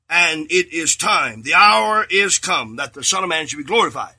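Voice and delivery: ordinary delivery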